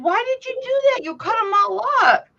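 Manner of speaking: high, scolding question; mock scolding. Aunt scolding her nephew, high mock reproach. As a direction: chide them for cutting the plants. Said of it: "I said".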